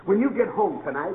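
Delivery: ordinary delivery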